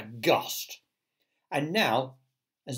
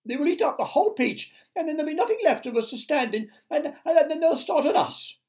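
high, loud, hurried panic; frantic spider wailing; panicked cry; 7 dB louder than usual. A narrator's reading. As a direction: gabble it in rising despair. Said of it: "cried Miss Spider".

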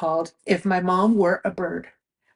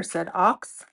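ordinary delivery, nothing marked

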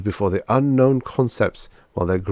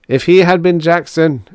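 loud, high emphatic declaration; raised, ringing robot proclamation; exclaiming; 9 semitones higher, 8 dB louder than usual. A narrator's reading. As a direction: exclaim it with a believer's certainty. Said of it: "exclaimed two oh four".

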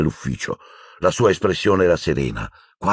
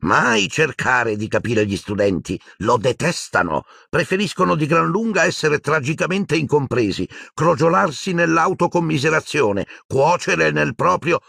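mocking Phineas; 10 semitones higher than usual. A narrator's reading. Portrait mocking students to the headmaster, higher and sneering. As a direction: lecture with lofty disdain. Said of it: "disse Phineas Nigellus malizioso".

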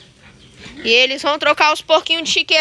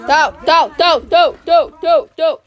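loud, repeated one-syllable call; calling animals; 5 dB louder than usual. Real, not acted. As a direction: summon the pigs with punchy, even bursts. Calling the pigs. (chamando os porcos em voz alta)

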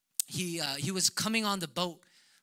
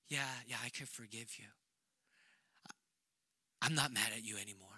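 soft forgiving wife; 8 semitones lower, 11 dB quieter, faster than usual. Retold or imitated. Wife voiced forgiving him.

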